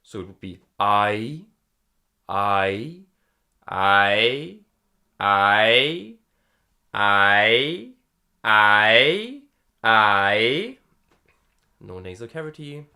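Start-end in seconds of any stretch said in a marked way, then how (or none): 0.8-10.8 loud I sliding up in pitch each time; rising twanged I. Shown as a demonstration. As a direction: sing the I with a rising slide, with twang. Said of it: "I have to combine them".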